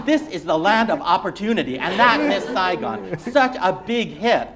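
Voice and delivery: high, loud, laughing dad; a proud father showing off clippings; gleeful banker dad; boasting immigrant father. Acted. Reader voices the immigrant father.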